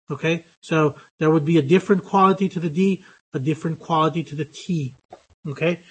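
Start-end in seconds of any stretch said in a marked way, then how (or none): none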